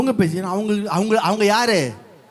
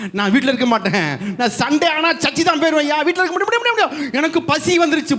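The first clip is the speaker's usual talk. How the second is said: eager believer; 10 semitones higher, faster than usual. Retold or imitated. Voices eager reborn believer.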